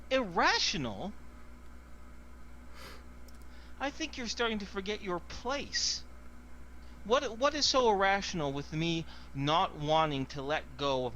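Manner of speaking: high, indignant challenge; guard rounding on the crew, indignant; outraged retort. Acted. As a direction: put them in their place, affronted.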